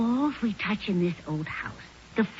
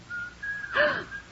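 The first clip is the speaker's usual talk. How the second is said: high, frightened gasp; startled gasp; 5 dB quieter than usual. Acted. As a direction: gasp in sudden shock. Startled in the rocking chair.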